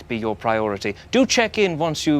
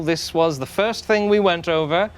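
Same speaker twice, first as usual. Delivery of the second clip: usual speaking voice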